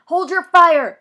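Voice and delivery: high, loud urgent order; yell. Acted. Police officer yelling at his men to hold fire. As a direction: shout to stop the shooting. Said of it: "voice yells".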